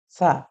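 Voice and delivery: "সা" with a thin stream of air at the teeth; tongue to the upper teeth, release a faint "সা"; faint airy sound. Shown as a demonstration. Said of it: "একদম ক্ষীণ আওয়াজ হবে".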